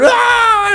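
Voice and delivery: high, drawn-out mock death scream; imitated scream. Sidekick screaming as his light goes out, mock scream.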